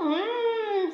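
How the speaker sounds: ordinary delivery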